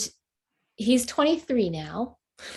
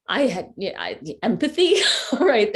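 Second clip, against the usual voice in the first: a rueful laugh breaking through the words; laughing at herself; 2 semitones higher, 4 dB louder than usual. Real, not acted. Mom admitting she lacked empathy, laughing. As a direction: laugh it off, a bit louder at the end. Laughing at her past self.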